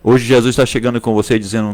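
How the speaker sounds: normal talking voice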